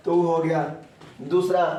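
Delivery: ordinary talk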